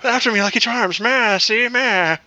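silly high Irish leprechaun voice; mock Irish leprechaun. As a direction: babble like the cereal leprechaun in Irish. Continuing the leprechaun impression.

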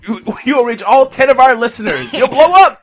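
hyped sales pitch. Pretend. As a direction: pitch it like a cheesy ad. Mock pitch to sponsor.

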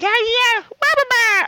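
high squawking yeah yeah wah wah; mock bird squawk. Mocking the birds squabbling outside Jeff's window, high squawk. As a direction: mimic birds arguing, high and nasal-free chatter. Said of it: "They're like".

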